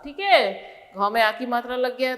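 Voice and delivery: ordinary talk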